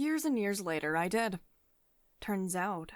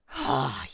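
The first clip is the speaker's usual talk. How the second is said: loud sigh; 6 semitones lower, 7 dB louder than usual. Acted. Exasperated sigh before snapping.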